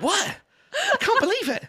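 squeaky high outraged voice; hammed-up shock, voice way up; indignant brother. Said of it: "he was so pissed off".

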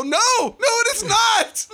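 high, loud, slow emphatic shout; loud agreement. Retold or imitated. Answering his wife in the theater, loud, high, slowly drawn-out shout. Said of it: "Like, really loud in the theater".

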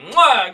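big smacking air kiss; loud kiss. Pretend. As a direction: blow a loud, high kiss. Signing off the stream.

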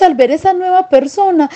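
usual speaking voice

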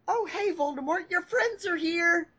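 high, bright cheering call; cheery assistant voice. Acted. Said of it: "cheered his assistant".

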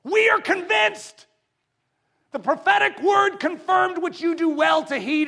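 high, loud shout from the pulpit; preaching shout. Acted. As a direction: declare it with full force. Shouted proclamation.

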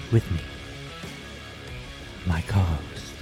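normal talking voice

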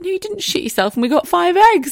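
high, loud joyful news; nurse with good news. Retold or imitated. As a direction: announce it with delight. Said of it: "She was like".

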